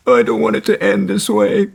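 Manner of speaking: high, pleading mock whine. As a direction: parody a distraught bandmate. [name] pulling his hair, distraught.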